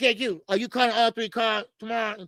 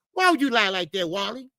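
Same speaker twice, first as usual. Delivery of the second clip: ordinary talk, nothing marked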